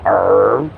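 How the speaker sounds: low rumbling mock stomach growl; imitating a stomach growling all night. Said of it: "You might hear it right now".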